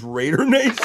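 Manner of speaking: a touch of a loud fan chant; light mock rally cry; mock cheer. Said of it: "Matt says".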